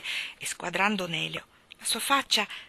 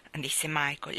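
plain reading voice

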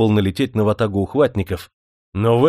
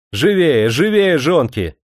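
raised, drawn-out hurry-up call; urging shout; 6 semitones higher, 4 dB louder, slower than usual. A narrator's reading. Strong woman hurrying the others at the dam, urging shout. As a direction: drive them on with loud high calls. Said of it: "покрикивала на женщин".

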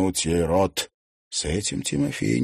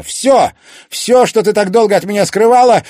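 very loud, high-pitched outburst; accusing shout; 13 dB louder than usual. A narrator's reading. Son confronting his secretive mother, shouting. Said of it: "крикнул Тимофей".

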